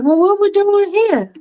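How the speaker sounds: a fussy woman hedging politely; Faith's fussy voice. Acted. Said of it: "she's like".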